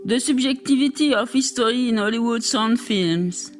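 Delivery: English titles in a strong French accent. Real, not acted. Reading English chapter titles from a French text, French accent. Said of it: "J'ai un accent terrible".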